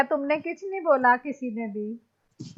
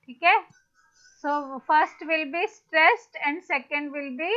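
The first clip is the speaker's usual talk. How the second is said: ordinary delivery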